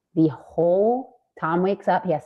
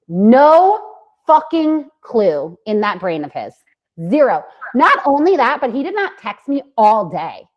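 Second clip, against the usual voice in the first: loud, higher, outraged; indignant rant; 6 semitones higher, 8 dB louder than usual. Retold or imitated. Telling co-host about Tom's cluelessness, loud indignant rant. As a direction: let the outrage burst out. Venting about partner's obliviousness.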